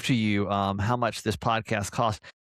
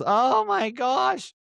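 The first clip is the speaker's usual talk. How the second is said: mock dismay; 14 semitones higher, 5 dB louder, much slower than usual. Mock anguish over comments.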